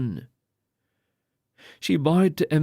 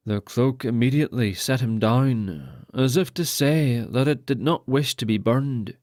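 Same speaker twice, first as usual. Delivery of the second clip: usual speaking voice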